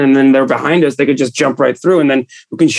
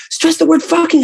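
high mock director repeating the note; mock crew note. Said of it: "They're like".

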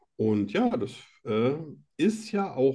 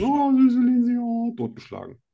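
mock dismay; 8 semitones higher than usual. Acted quote of the reader's shock.

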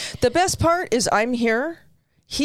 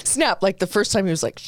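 ordinary talk

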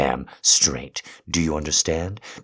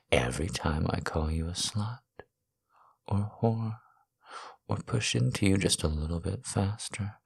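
soft murmur; 9 dB quieter than usual. Acted. Soft intimate turn in roleplay.

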